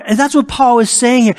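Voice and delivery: normal talking voice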